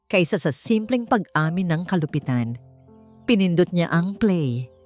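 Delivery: ordinary delivery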